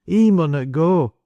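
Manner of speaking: put-on high, slow boy's insistence; insistent. A narrator's reading. Boy repeats his warning to the adults, insistent. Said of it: "persisted Jud".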